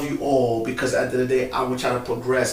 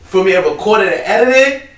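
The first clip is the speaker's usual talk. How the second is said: excited; 7 semitones higher, 10 dB louder than usual. Real, not acted. Excited about bringing a drone.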